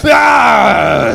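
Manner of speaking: loud, high fake yell of horror; hammed-up gasp of shock; over-the-top horrified cry; mock shocked shout.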